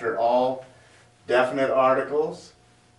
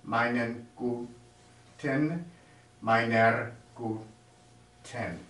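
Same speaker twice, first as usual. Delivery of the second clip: slow careful drill; 3 dB quieter, much slower than usual. Shown as a demonstration. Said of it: "drilling the plural endings".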